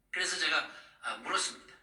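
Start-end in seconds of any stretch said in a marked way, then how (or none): none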